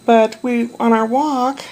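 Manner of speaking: normal talking voice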